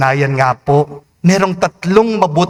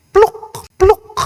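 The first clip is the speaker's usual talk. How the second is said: high, short plopping noise; imitated plop; 3 dB louder than usual. Priest mimics what was heard from the boat, imitated plops. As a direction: pop out two high-pitched plops. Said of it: "meron po akong naririnig".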